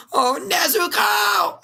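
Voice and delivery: very high, slow, shouted wail; Zenitsu wailing. Retold or imitated. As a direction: wail her name like a lovesick coward. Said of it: "he can be like".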